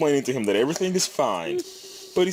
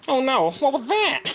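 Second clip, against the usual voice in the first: high, frightened squeal; panicked overbite voice. Acted. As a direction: panic like the train will crash.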